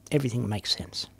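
whispered title, fast. Acted. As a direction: breathe the title out.

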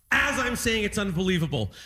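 ordinary delivery

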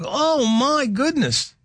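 slow, high mock amazement; amazed. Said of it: "I'm like".

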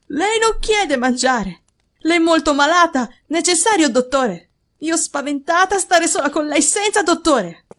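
put-on high, insistent servant girl; agitated maid. A narrator's reading. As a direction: press the alarm on your master. Alarmed maid insists on a doctor.

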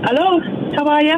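put-on Irish accent creeping in; a trace of Irish in [name]'s voice; slipping Irish accent. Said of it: "[name], you've got an accent now".

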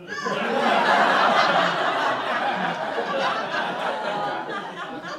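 long, hammed-up intake of breath; long delighted gasp. Rider spots the sandwich guy, long excited gasp. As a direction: gasp in mock amazement. Acting out his delighted realisation.

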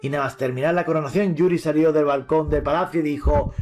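usual speaking voice

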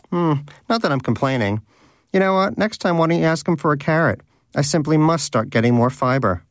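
pleased with himself after the apple, he needles Donut in a smug voice; smug Danish. Acted. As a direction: lord it over him with lofty mockery.

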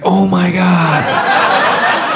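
very slow, drawn-out groan of words; dismayed. Acted. Comic learns of conference call, slow dismay. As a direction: stretch it out in dread.

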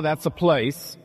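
ordinary talk, nothing marked

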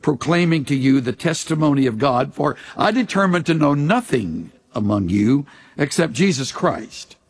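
low, measured Bible reading; scripture reading. Acted. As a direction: read the passage solemnly, dropping low at phrase ends. Reads scripture, music underneath.

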